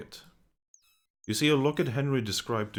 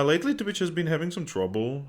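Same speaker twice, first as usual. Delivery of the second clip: ordinary delivery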